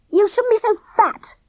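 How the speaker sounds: high, mocking and blunt; heartless. A narrator's reading. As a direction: cut him down coldly. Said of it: "Clipper said heartlessly".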